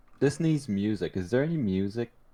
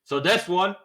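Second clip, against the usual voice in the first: raised, booming ship captain; 11 semitones higher, 7 dB louder than usual. Shown as a demonstration. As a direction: read it like a captain. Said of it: "this is my captain voice".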